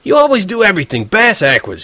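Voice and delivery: high, scornful angry jab; furious roommate scolding, high. Acted. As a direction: scold him with contempt. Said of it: "He really was furious".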